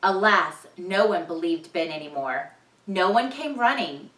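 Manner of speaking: ordinary delivery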